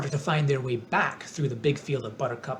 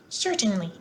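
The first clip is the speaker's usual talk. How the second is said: mouse queen voice; 6 semitones higher than usual. A narrator's reading. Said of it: "answered the Queen".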